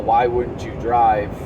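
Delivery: ordinary talk, nothing marked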